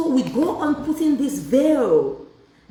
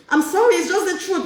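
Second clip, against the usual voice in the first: laughing; 8 semitones higher than usual. Real, not acted. Laughing at her own joke.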